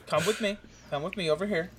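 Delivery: normal talking voice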